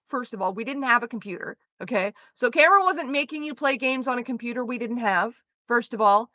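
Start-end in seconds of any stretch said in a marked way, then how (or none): none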